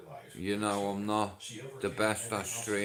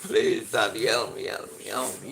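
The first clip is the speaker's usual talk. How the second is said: a lightly voiced, panicky kid begging his dad, lower and fading; mimicked child's plea; 4 semitones lower, 3 dB louder than usual. Stung son begging his dad for help, mock panicky plea. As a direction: beg for help like a scared little boy, half-acted. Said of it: "and he's like".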